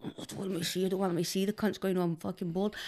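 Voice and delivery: softly, bored inner voice; quiet bored mutter. Retold or imitated. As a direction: mumble it like you're bored stiff. Retold bored thought while yawning.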